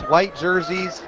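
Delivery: usual speaking voice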